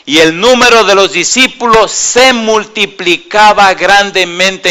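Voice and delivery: loud, high, slow preacher's call; fiery sermon delivery, slow and loud; preaching at full force. Acted. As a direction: rouse the congregation from the pulpit. Preaching scripture and appeals.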